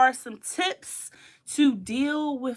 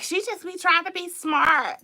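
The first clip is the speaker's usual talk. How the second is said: mock critic; 7 semitones higher, 3 dB louder than usual. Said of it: "I know people be like".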